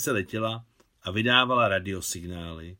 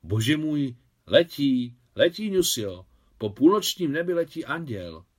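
higher, louder rapture at the sky; awed excitement; 5 semitones higher, 5 dB louder than usual. A narrator's reading. Maria to Nusia as the satellite flies over, in awe. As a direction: marvel aloud, almost breathless with wonder. Maria sees the flying star.

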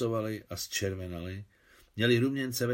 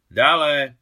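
loud, higher-pitched call toward the door; shouted; 5 semitones higher, 13 dB louder than usual. A narrator's reading. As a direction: call out sharply to whoever knocked. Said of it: "křikla Alexandra".

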